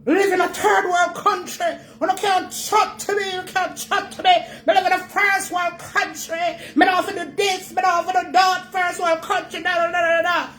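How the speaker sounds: mocking show-off. Mocking emigrants who boast about first world life, a high snooty imitation. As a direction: mimic a bragging emigrant looking down on you. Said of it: "on a class".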